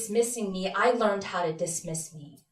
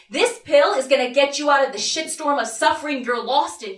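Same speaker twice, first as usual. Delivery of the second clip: higher, louder emphatic sales pitch; psychiatrist's promise; 4 semitones higher, 5 dB louder than usual. Acted. Psychiatrist selling a pill to a teen, emphatic. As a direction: push the promise, raised and insistent. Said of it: "he says".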